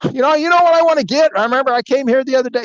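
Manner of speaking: high, loud, rushed eager pitch; high fast eager voice. Retold or imitated. Buyer talking his way in, high, loud and fast. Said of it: "I'm like".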